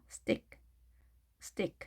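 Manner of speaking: 스틱 with T hardened to ㄸ, like 스띡; tense T. Shown as a demonstration. Teacher repeats the model for learners, tense-T 스띡. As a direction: say 스틱 as a soft 스띡, no puff on T. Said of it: "트도 뜨로 하셔야 되겠죠".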